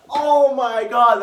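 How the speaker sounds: loud, drawn-out exclamation; real gushing fan cry; overwhelmed outburst. Real, not acted. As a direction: wail it out, high and slow. Overwhelmed by the emotional moment.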